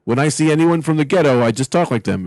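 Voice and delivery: normal talking voice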